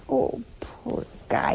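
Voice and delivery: soft, slow breathy sigh of pity; a tender "poor guy", drawn out. Acted. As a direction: coo it like you feel sorry for him. Pitying Paul's bad day.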